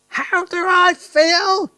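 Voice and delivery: high, loud, slow angry shout; tiny grandma yelling furiously at her daughter. Acted. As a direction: spit it back in a rage. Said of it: "she yelled".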